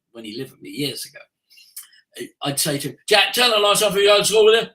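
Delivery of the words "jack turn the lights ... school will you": loud, hurried order to Jack; rushed bark at son. Retold or imitated. Father barking at son Jack to turn lights off. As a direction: snap the order out fast and loud. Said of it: "And I'd say it like that very quickly".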